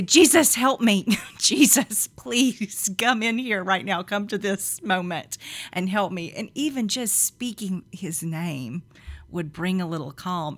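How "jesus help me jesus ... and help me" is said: overwhelmed plea. Retold or imitated. Overwhelmed mom with kids, loud mock plea. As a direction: cry out for help, hammed up. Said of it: "I would just say".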